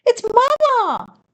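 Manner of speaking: high, slow, happy call; joyful cry. Acted. Margot calling out as she spots Mama's boat, high and joyful. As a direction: call out with relief.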